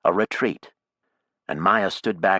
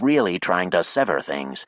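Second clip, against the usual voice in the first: ordinary delivery, nothing marked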